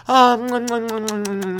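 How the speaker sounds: high moan into smooching sounds; hammed-up make-out noises; mock kissing noises. Comedian acting out a hookup in the alleyway, exaggerated kissing.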